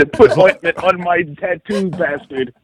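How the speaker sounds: high, slow haughty command; mock bossy demand. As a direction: order him around like a diva boss.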